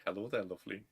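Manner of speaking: low, hushed smooth-talker impression; very soft, low, imitated suave greeting; suave ladies' man. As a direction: murmur it coolly, playing the charmer. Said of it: "like, suave".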